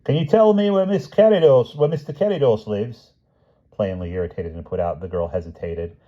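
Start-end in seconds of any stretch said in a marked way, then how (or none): 0.0-3.1 high, loud call from the gate; delivery driver calling out. A narrator's reading. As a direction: call across to them. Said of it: "who called".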